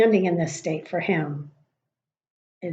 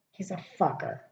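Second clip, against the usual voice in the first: ordinary talk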